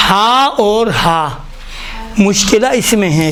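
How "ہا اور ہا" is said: ہا and ہا drawn out slowly to contrast the two h sounds. Shown as a demonstration. Teacher contrasting two ha letters for students, slow and deliberate. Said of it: "دیکھیں".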